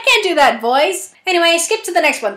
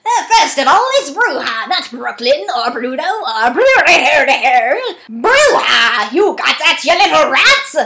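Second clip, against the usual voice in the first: witch voice; 3 dB louder than usual. Acted. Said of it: "I just have to do a witch voice".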